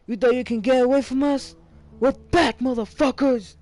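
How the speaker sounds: high, loud mocking shout; taunting yell. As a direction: yell it like a gang ambushing its victims. Voicing the hostile townsfolk.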